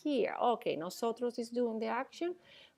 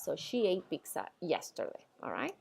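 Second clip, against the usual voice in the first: usual speaking voice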